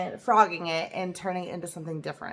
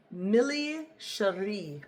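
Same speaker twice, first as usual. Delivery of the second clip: Millie Cherie slowly and deliberately, each syllable weighted; overdone emphasis on Millie Cherie; heavy emphasis; much slower than usual. Real, not acted. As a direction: lean hard on Millie Cherie, drawn out. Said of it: "I probably put way more emphasis on that".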